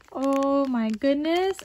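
ordinary talk, nothing marked

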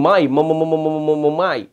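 "my" repeated quickly again and again; repeated first syllable. Shown as a demonstration. Teacher demonstrating Spigelia repeating the first syllable. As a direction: stutter on "my" like a stuck record. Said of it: "when he's saying".

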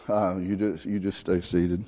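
ordinary talk

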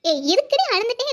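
loud scolding call to a sister; sharp call. Acted. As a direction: snap loudly to stop her leaving. Sister scolds the sulking girl.

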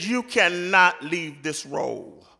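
ordinary talk, nothing marked

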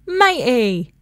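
falling call to a mate; shouting a name. Acted. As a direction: shout it out, landing firmly. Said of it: "Someone sang out".

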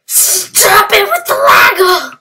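high, loud, drawn-out shout of frustration; exasperated yell. Real, not acted. Gamer to his lagging game, exasperated yell. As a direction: yell it slowly at the misbehaving game.

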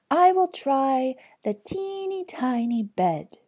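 lightly voiced storybook heroine; Goldilocks speaking. A narrator's reading. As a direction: lift the voice high for little Goldilocks. Goldilocks decides aloud in the tale.

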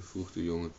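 usual speaking voice

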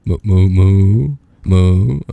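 slow, drawn-out sing-song moo; toddler mooing. Pretend. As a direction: moo like a little kid playing. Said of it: "I feel like a toddler".

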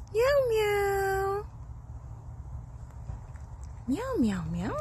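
high, soft, drawn-out meows; playful human meowing; cat imitation. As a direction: meow softly to the cat.

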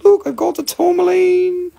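high, showing-off customer voice; mock customer voice. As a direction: show off the pendant proudly. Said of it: "be like".

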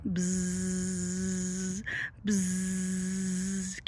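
low droning buzz like a bee; playful bee buzzing. Shown as a demonstration. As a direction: buzz like a bumblebee for the kids. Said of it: "make a sound like a bee".

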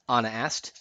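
usual speaking voice

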